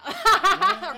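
loud laugh. Real, not acted. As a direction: burst of laughter. Laughs at joke.

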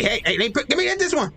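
normal talking voice

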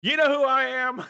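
loud, high entitled outburst; indignant. Pretend. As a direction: demand it like a VIP. Acted indignant customer.

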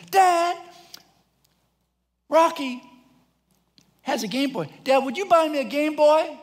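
put-on high, loud little-boy voice; excited pleading kid. Son runs in wanting a Game Boy, excited. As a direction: imitate a child begging for a toy.

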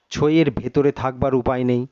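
usual speaking voice

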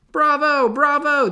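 high, loud cheering crowd. Acted. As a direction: shout bravo like a thrilled audience. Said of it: "they cheered".

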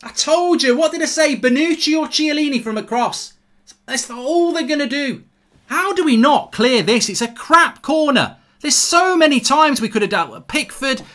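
high, loud exasperated tirade; indignant told-you-so outburst; real angry rant. Real, not acted. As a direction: rail at the defence for a bad goal. Furious rant about conceded corner.

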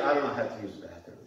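low, soft, fast sheepish mumble; sheepish Kevin. Retold or imitated. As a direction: confess it a bit embarrassed.

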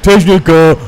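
loud call across the room; shouting. Acted. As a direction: yell for the technician. Calling for the technician.

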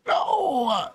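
high, crying mock wail; drawn-out high cry of protest; playful wail. Caught up in game chase.

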